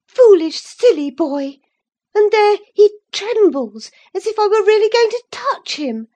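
high, loud furious rebuke; passionate scolding. A narrator's reading. Catherine scolding Linton in a passion. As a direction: tear into him with scorn. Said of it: "in a passion".

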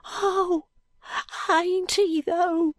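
high-pitched doubting urchin; small high voice, fearfully unconvinced. A narrator's reading. Jo doubting he is out of earshot, high voice.

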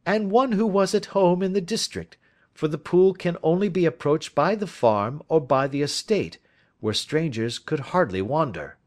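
a confident detective laying out his case; lightly voiced detective. Detective asking his friend for advice, lightly voiced. Holmes continuing his deduction.

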